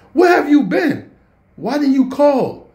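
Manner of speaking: high, loud angry yell at his mom; furious shout. Retold or imitated. Teen yelling at mom who came home at five, furious. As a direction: demand where she has been, shouting up high. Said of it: "and I am furious".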